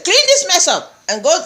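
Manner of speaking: high-pitched, upset mock order. Mother can't punish master's son, upset high mimicked order. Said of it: "Very upset".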